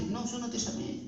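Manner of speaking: put-on a hushed, drowsy no from the old priest; sleepy murmur. Old priest, woken again, sends the boy back drowsily. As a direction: mutter it half asleep.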